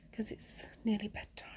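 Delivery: soft unvoiced whisper. Real, not acted. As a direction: whisper so nobody wakes. Whispering at bedtime.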